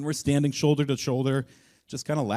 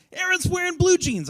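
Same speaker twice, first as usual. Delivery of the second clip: high, louder excited cry; excited classmates; 6 dB louder than usual. Retold or imitated. Classmates greeting his new jeans, excited. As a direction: call it out like thrilled schoolkids. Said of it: "Everybody was so excited".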